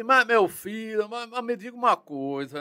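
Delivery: the general pressing, Northeastern lilt; Northeastern accent. Retold or imitated. General questioning the detective, Northeastern accent. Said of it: "Aquele sotaque de nordestino".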